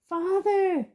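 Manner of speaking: high, pleading cry; desperate plea. Acted. Boy in chains begging his father, high desperate plea. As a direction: beg your father, voice cracking high.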